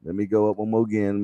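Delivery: usual speaking voice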